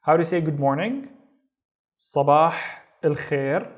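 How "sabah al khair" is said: Sabah, pause, al khair; word by word. Shown as a demonstration. Teacher reviewing good morning, Sabah al khair in pieces.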